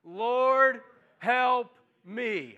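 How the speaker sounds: loud cry, high on Lord. Shown as a demonstration. Pastor leads the congregation to cry out loud. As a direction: call Lord, help me out loud with the room. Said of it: "Just say that with me out loud".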